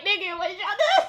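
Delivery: high, laughing mock outcry; laughing disbelief. Joking about a couple's sudden argument, laughing disbelief. Imagined reaction to arguing couple.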